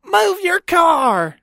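high, drawn-out mock holler; mock yelling. Woman in car orders him to move, high drawn-out mock yell. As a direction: imitate her calling out, stretching each word high. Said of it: "she jumps in the car and she's like".

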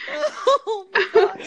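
gasped through laughter; laughing. Real, not acted. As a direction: speak while still laughing. Laughing fit.

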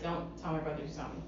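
low quiet urgent plea; hushed plea. Retold or imitated. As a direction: murmur it low, worried. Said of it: "I'd be like".

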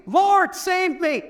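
a mock scream for help, high and slow; retold scream. Peter sinking in the water, mild mock scream. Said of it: "all I could do was scream".

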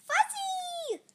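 put-on very high, loud little-girl squeal; delighted squeal. A narrator's reading. Little girl admiring hulking monster, delighted squeal. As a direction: squeal it with glee. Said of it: "and said".

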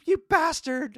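high-pitched imitated indignation; mock outrage. Movie fan voices Sarah cursing the guy, mock outrage. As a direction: imitate her wailed accusation.

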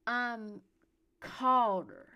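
put-on loud drunken announcement; slurred. A narrator's reading. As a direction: proclaim it tipsily, slurring. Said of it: "he slurred".

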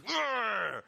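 loud, high, hammed-up "grr"; mock fighter's growl. Fighter faces opponent at press conference, mock growling yell. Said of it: "It's like yelling at each other".

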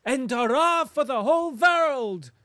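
loud, high, joyful cry of hurrah; cried out. A narrator's reading. German farmer cries his hurrah to Rustov, loud and joyful. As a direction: throw the cheer out to the whole world.

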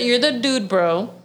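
usual speaking voice

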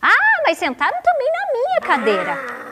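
high-pitched put-out whine; indignant storybook mama bear; complaining mama bear. A narrator's reading. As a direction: protest in a high mother-bear voice. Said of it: "A mamãe ursa reclamou novamente".

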